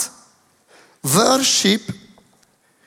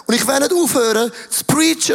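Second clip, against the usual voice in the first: usual speaking voice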